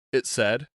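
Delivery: plain reading voice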